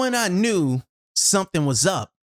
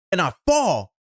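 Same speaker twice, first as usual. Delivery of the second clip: ordinary talk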